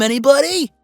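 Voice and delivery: high, loud rising question; playful, sing-out appeal; teasing rallying call. Acted. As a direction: toss it out to the crowd, swooping upward. Rallying viewers for the idea.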